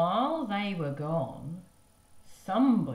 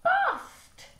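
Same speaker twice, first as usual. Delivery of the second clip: put-on very high loud toddler squeal; baby voice; 8 dB louder than usual. Baby announcing the broken chair, loud high squeal. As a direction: squeal it like a tiny child.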